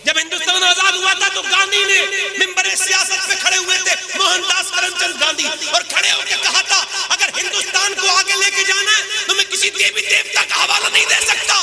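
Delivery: high, loud impassioned preacher cry; preaching at full force. Acted. Preacher voicing a caliph's reply to the crowd, impassioned and loud. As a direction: belt it out to the whole congregation. Preaching climax about Gandhi.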